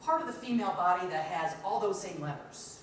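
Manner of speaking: normal talking voice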